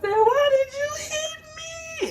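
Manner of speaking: very high, slow wail; little girl wailing at her grandma; crying child. Retold or imitated. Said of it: "And I said".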